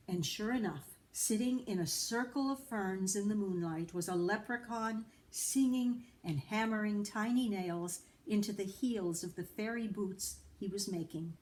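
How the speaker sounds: ordinary delivery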